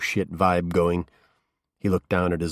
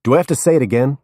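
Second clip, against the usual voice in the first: louder, higher sharp snap; snapped; 4 semitones higher, 7 dB louder, faster than usual. A narrator's reading. Detective snapping to get the drunk host's attention. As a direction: cut him off hard.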